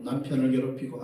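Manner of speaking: ordinary delivery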